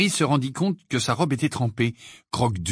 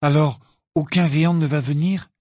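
let-down question, pitched up; acted disappointment, mild; disappointed; 4 semitones higher, 4 dB louder than usual. A narrator's reading. Ron learns no giant will come, disappointed. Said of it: "dit Ron déçu".

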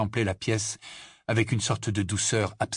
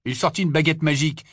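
usual speaking voice

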